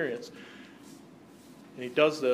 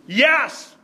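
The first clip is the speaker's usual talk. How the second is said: loud, high-pitched divine confirmation; resounding, emphatic cry from the Father; booming affirmation; 8 semitones higher, 9 dB louder, much slower than usual. Acted. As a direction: shout it out as God confirming it.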